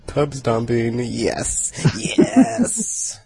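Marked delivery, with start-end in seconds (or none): 2.2-3.2 gleeful whispered yes. Pretend. Gleeful at pub stomping.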